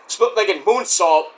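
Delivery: usual speaking voice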